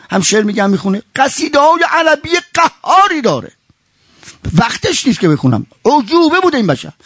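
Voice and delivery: ordinary talk, nothing marked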